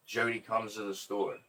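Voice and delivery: ordinary delivery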